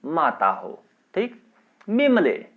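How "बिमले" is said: high, loud call of the name. Acted. Mother-in-law shouting for Bimla, high and loud. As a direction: yell for her from afar.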